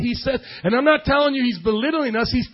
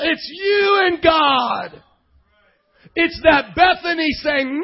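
raised, drawn-out pulpit shout; full-force preaching; 5 semitones higher, 4 dB louder, slower than usual. Real, not acted. Preacher to congregation on you and God, full-force preaching. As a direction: drive it home with stretched key words.

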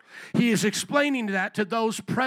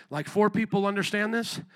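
usual speaking voice

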